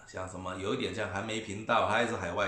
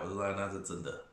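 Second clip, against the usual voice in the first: ordinary talk, nothing marked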